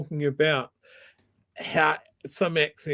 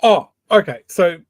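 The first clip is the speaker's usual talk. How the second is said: mock alarm; 5 semitones higher, 9 dB louder, faster than usual. Co-host calls out a tangent.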